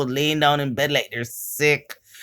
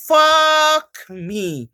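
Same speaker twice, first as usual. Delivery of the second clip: higher, louder exasperated fuck me; exasperated curse; 9 semitones higher, 3 dB louder than usual. Real, not acted. Embarrassed vlogger cursing her own blunder, exasperated. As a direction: curse yourself out loud for the blunder.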